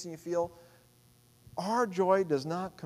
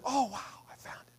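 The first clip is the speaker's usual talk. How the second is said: whispered delight; 6 semitones higher, 5 dB quieter than usual. Retold or imitated. Retelling finding a lost thing.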